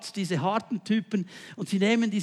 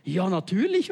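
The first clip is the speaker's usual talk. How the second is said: ordinary talk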